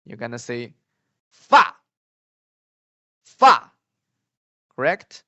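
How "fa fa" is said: "Fa" starts very high and loud, drops sharply; fourth tone. Shown as a demonstration. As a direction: hurl "fa" down in one abrupt stroke. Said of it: "give the last tone".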